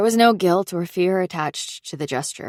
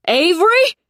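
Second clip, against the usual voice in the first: high, loud panicked shriek of a name; 13 semitones higher, 8 dB louder than usual. Acted. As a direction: scream the name into the phone, frantic. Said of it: "he shrieked in lieu of hello".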